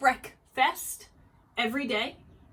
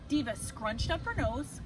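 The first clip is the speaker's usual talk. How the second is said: ordinary delivery, nothing marked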